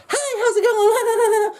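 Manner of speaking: very high, drawn-out fake-friendly greeting; hammed-up phony customer-service glee; fake cheer. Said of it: "be fake".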